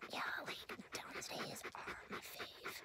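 soft breathy whisper as the dog; dog's inner voice. Acted. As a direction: whisper the dog's wise words. Voicing the dog.

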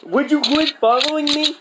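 very high, loud exasperated demand; angry. Real, not acted. Gamer demanding the other player stop following, angry. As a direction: yell it, fed up with being tailed. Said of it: "Why are you so angry?".

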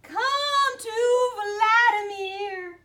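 high, loud, chanting monster wail; spooky sing-song creature call; icy monster call. A narrator's reading. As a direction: summon them with a slow eerie howl. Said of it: "the monster continued".

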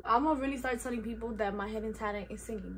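ordinary talk, nothing marked

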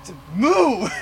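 high, very loud yell; angry shout. Real, not acted. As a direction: scream at the car to get going. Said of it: "Such an angry player".

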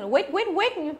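rapid-fire mistaken "weight" with no glide; wrong vowel demo. Shown as a demonstration. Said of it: "không đọc như thế".

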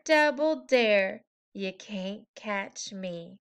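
ordinary delivery, nothing marked

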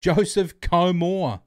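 stretched-out loud call of the name; loud shout-out. Real, not acted. Streamer shouting out a donor's name loudly. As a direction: call the name out slowly and proudly.

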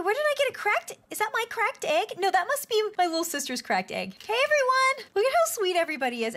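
usual speaking voice

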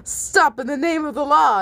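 high-pitched mild screech. A narrator's reading. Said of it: "you screeched".

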